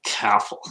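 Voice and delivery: "Careful" with the R dropped, like "caffle"; echoed accent. Retold or imitated. Host echoing the guest's word, echoed accent.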